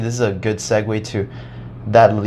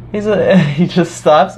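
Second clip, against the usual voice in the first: ordinary delivery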